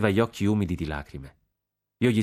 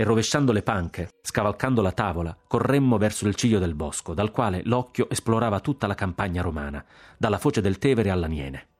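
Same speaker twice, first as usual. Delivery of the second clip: ordinary delivery, nothing marked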